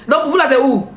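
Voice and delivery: usual speaking voice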